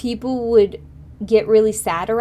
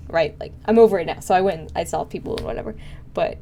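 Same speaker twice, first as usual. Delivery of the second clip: ordinary delivery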